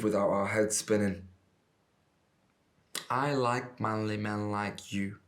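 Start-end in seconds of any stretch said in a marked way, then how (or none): 3.1-5.2 slow, drawn-out drawl; lazy tipsy compliment, words stretched; drunken drawl. Acted. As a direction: drawl it out slowly as a drunk admirer. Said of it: "she drawled at me".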